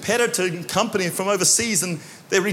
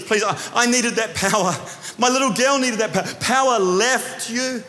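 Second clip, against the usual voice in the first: desperate plea; 6 semitones higher, slower than usual. Retold or imitated. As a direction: beg as if your child's life slips away. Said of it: "I'm over here going".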